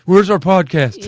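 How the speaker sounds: loud, slow, whiny complaint; mock mad fans. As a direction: imitate entitled fans bellowing a demand. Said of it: "they get mad".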